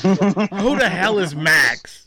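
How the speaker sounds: slow, laughing "Who the hell is Max?"; laughing mob voice. Retold or imitated. Laughing while imitating the mobster asking about Max. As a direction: drag it out through laughter like a tough guy. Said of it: "when he goes".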